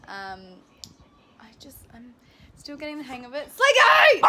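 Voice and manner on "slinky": loud high yell at the dog; shout. Real, not acted. Catching Slinky misbehaving, loud high shout. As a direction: yell the dog's name sharply.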